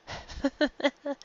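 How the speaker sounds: a sudden high-pitched giggle; bright character giggle. Acted. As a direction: giggle like you can't hold it in. Character laughs at the joke.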